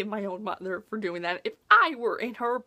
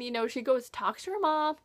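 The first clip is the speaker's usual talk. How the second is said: ordinary talk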